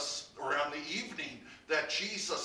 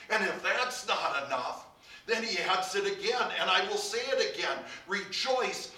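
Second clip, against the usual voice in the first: louder, quicker rising repeat; building preaching; 3 semitones higher, 6 dB louder than usual. Acted. Preacher urging his flock to rejoice again, building force. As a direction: drive the repeat home.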